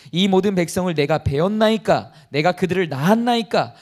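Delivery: loud solemn reading of Moses' complaint; scripture reading. Acted. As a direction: read the verse out firmly. Reads Moses' complaint from Numbers. (성경 구절을 힘 있게 낭독)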